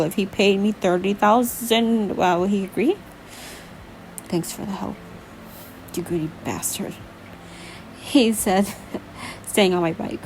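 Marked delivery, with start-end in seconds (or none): 4.2-6.9 low, soft, injured mumble; pained speech. A narrator's reading. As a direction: speak low, sparing your breath. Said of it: "it was painful for him to speak".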